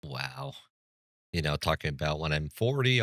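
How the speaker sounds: normal talking voice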